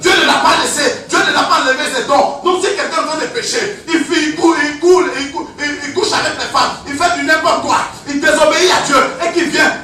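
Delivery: loud, driving preacher's cadence with laughs; preaching at full force. Acted. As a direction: hammer the point home to the congregation. Sermon rising against the devil.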